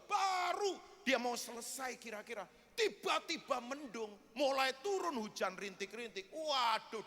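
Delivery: ordinary delivery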